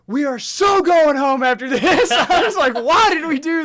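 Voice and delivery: high, loud panicked shout; screaming in terror. Retold or imitated. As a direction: scream it like a terrified kid. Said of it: "I just scream".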